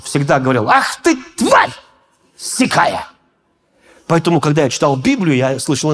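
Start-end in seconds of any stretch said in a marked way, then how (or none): 0.7-3.1 high, drawn-out mock yell of «Ах ты, тварь»; mock yell at grandma. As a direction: imitate the grandfather yelling at grandma. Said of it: "когда орал на бабушку".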